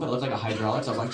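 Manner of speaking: usual speaking voice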